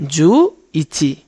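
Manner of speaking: "জু ইচি" slowly, each syllable drawn out; slow demo. Shown as a demonstration. Teacher shows how eleven is built, slowly. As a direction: spell it out slowly for learners. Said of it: "আর এককে বলি ইচি".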